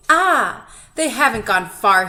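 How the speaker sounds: loud and hopeful; excited. A narrator's reading. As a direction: call it out eagerly. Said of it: "he said".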